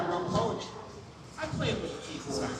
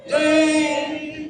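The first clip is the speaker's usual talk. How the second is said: loud happy cheer; 8 semitones higher, 9 dB louder than usual. Real, not acted. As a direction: shout it with joy. Cheering on arrival.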